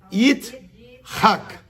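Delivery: Yitzhak with a throaty h, stress on the last syllable; Hebrew pronunciation. Shown as a demonstration. Teacher showing a student how to say Yitzhak. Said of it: "I T Z".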